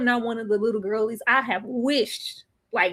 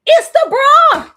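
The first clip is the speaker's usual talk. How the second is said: louder, higher and slow; emphatic reveal; 13 dB louder, slower than usual. Real, not acted. Telling viewers the bra is the culprit, loud and slow. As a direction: land the punchline hard.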